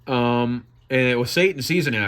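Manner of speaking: ordinary delivery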